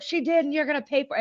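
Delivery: quick, high mock comeback; arguing back. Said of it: "Cheeto is fighting him".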